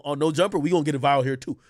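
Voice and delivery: normal talking voice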